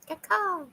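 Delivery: a tired crow call. Acted.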